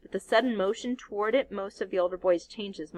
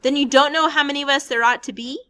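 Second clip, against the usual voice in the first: loud, higher, insistent ask; pressing question; 7 semitones higher, 10 dB louder, faster than usual. Acted. Ralph demanding how many boys there are, loud. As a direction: push him for an answer.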